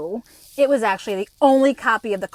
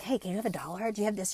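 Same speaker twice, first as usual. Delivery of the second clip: mumbled beggar; 3 semitones lower, 11 dB quieter, faster than usual. Retold or imitated. Imitating homeless people asking money.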